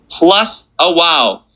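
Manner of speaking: normal talking voice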